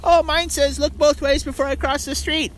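put-on high kid voice, chirpy and proud; lightly voiced child reciting. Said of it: "one kid's like".

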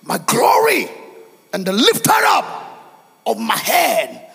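soaring, drawn-out declamation; full-force preaching. Acted. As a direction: proclaim it loudly to the whole church.

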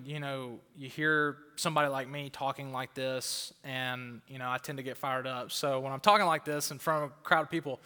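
ordinary talk, nothing marked

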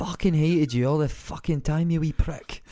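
broad Scottish clairvoyant with a mock-spiteful sneer; Scottish psychic spitting insults from the dead; Scottish accent. Mate mimics Limmy's clairvoyant in Scottish accent, mocking. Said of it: "until you do a Scottish accent".

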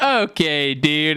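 friend's catchphrase echoed back, lightly imitated; mildly put-on catchphrase reply; Fielding. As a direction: mimic the friend replying in kind. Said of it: "he'd respond with".

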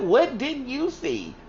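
high, slow disbelief; the friend questioning her, drawn out and high; incredulous. Retold or imitated. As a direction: stretch it out in disbelief. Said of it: "She was like".

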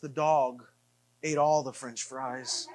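low, subdued retold announcement; Christy breaking bad news. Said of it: "And she says".

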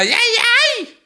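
very high ranchero yelp; mock cowboy cry, leaping way up in pitch; shouted cowboy whoop. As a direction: let out a piercing, playful holler. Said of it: "Y grita".

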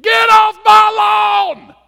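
shrieked high and slow; cranky neighbor screaming at kids to get off his lawn; angry scream. Retold or imitated. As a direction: yell like a furious old neighbor.

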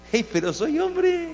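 high, slow, put-on tourist protesting; mock protest. Tourist told to cover up at the mosque, mock high protest. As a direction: squeak out an indignant protest.

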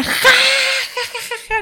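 very high, slow mock laugh; fake laugh. Friend laughing at a joke about her dad, high mock laugh. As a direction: ham up a forced laugh. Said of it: "la Karina".

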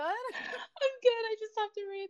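high, squealing through embarrassed laughter; embarrassed laughing. Real, not acted. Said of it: "getting so shy all of a sudden".

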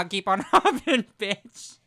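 laughing loud and high; cracking up mid-phrase. Real, not acted. Laughing at friend's joke.

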